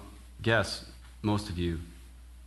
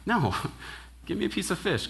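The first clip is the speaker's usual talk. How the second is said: 1 amused Jesus; 10 semitones higher, faster than usual. Retold or imitated. Jesus answering doubters, laughing.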